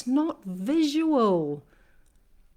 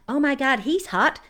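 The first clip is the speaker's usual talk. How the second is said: higher, lightly gushing mock reaction; 5 semitones higher than usual. Dating app user spotting someone hot, mock gushing. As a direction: imitate an excited swiper.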